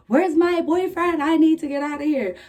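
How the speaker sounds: panicked pleading. Retold or imitated. Post-op patient demands her boyfriend from the nurse, high and frantic. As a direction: beg for your boyfriend in a rising panic. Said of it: "bugging, bro. Like".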